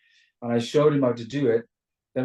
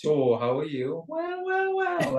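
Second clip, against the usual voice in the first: Welsh sing-song, swooping up high; lilting Welsh accent; 12 semitones higher, slower than usual. Shown as a demonstration. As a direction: demonstrate the up-and-down Welsh lilt. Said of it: "we tend to go up and down a lot".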